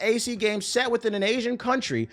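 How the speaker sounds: high, whiny mock read of the comment.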